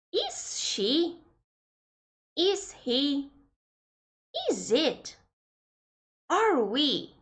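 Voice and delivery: clear, careful textbook questions; model question reading. Shown as a demonstration. Teacher reads example questions to students, carefully. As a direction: read each one out as a model.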